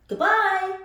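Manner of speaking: high, loud mock game-show goodbye; Vanna's goodbye. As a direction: sign off like Vanna, lightly imitated. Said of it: "as Vanna says".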